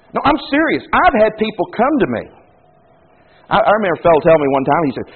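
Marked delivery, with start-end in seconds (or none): none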